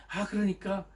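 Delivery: usual speaking voice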